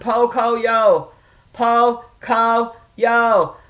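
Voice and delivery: "Poco yo" drawn out, syllable by syllable; slow repeated practice. Retold or imitated. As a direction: rehearse "Poco yo" very slowly with long vowels. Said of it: "I said out loud a bunch of times".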